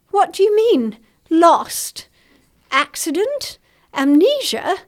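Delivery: mother breaking down about her missing son, shrieking; terrified shriek. Acted. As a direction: shriek it out, wild with fear. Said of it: "she shrieked as she burst into a flood of tears".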